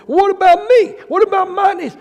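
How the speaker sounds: high, loud, mocking whine; whiny churchgoer. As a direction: mimic the selfish member. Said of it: "People be going".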